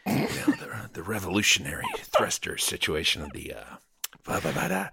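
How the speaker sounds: underplay it like a smoky, mumbling film star; cool, husky tough-guy line read; De Niro, smoky. Acted. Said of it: "I'm fucking De Niroing this shit".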